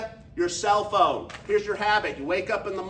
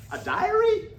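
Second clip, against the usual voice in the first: retold disbelieving question, much higher; incredulous; 5 dB louder, slower than usual. Young speaker to the seminar speaker, incredulous. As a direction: scoff at the idea of a diary. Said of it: "I said".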